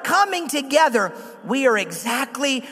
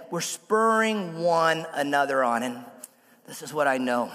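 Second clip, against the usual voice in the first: lower, much softer, sincere; earnest quiet appeal; 8 semitones lower, 6 dB quieter than usual. Real, not acted. Pastor leaving the church with a final word, hushed and sincere. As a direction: draw them in close and gentle. Closing sermon, intimate appeal.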